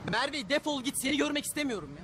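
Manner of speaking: loud and high, furious; angry yelling. Acted. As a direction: throw her out in a rage. Kicking girlfriend out, yelling. (öfkeyle bağırarak)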